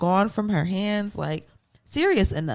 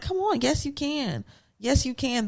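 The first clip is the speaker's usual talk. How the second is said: 1 encouraging Tyra; 5 semitones higher, 4 dB quieter than usual. Retold or imitated. Tyra urging Tiffany, retold.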